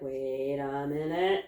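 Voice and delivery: slow, low, drawn-out quote; doubtful baby goats. Retold or imitated. Baby goats seeing the cat, slow doubt. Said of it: "the babies were like".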